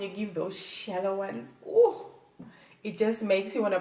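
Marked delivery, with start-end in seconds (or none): 1.7-2.1 high, loud ooh of delight; pleasured ooh. Shown as a demonstration. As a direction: let out a high, thrilled ooh. Reacting to the move she describes.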